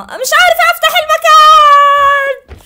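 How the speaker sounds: very high, loud, slow drawn-out whine; frustrated wail. Real, not acted. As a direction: wail it out in helpless panic.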